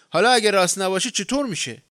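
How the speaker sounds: furious shout. Acted. Harry yelling at the old man over the chimney, furious shout. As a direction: yell it at the old man, nearly losing control. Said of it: "سر پیرمرد فریاد زد".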